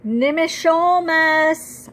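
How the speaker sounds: long, loud and high, calling out across the forest; slow frantic cry of a grandfather's name. Acted. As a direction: shout the name, desperate to find him. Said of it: "I call frantically".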